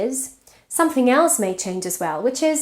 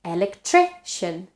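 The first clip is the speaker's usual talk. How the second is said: "electrician" stressed on the third syllable, -tri-; shifted stress; 2 semitones lower than usual. Shown as a demonstration. Teacher showing how stress moves, "electrician" stressed on -tri-. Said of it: "stress changes position in the syllable".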